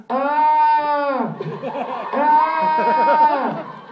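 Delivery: loud, high, hammed-up fake moans of pleasure; fake sexy moaning. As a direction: overact the moans, obviously faked. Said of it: "it was a little bit even sexier than that, but still as fake as that".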